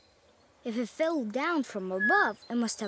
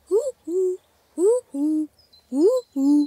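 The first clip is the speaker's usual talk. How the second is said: loud hooting owl calls, each louder; owl call imitation; 7 dB louder, slower than usual. Pick calling the owl in owl language, loud hoots. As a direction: hoot like an owl calling for her. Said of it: "I'll do it myself".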